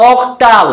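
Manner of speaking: অক্টাল with the ক্টা leaning toward আট; showing the sound. Shown as a demonstration. Tutor showing students the আট sound in অক্টাল. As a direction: say অক্টাল so the আট inside it rings. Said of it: "একটা আটের টান আছে না?".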